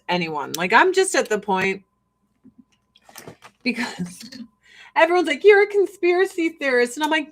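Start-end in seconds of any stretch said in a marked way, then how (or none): 5.4-6.9 higher, louder mocking quote; mock accusation. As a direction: imitate an accusing critic. Mocking what everyone tells the speaker.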